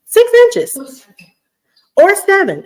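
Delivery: outraged squeal at the snow forecast; shocked disbelief. Real, not acted. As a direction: exclaim it like you can't believe it.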